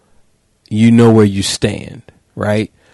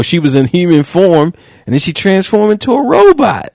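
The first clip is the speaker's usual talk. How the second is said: excited shout; 8 semitones higher than usual. Real, not acted. Host recalling Transformers scene, shouting excitedly. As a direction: yell it like the big reveal.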